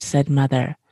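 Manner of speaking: usual speaking voice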